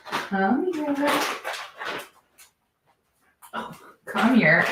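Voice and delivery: high coaxing pet voice; calling a dog. Real, not acted. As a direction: coax the dog close.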